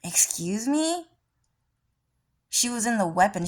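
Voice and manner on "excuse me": indignant Tifa. Retold or imitated. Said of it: "Tifa was like".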